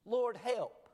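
high, loud retold plea; urgent cry for help. Preacher voicing Peter sinking in the sea, urgent cry. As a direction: cry out as if going under.

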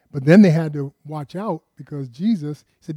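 ordinary talk, nothing marked

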